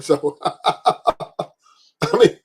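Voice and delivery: laughing. Real, not acted. Laughing at own joke.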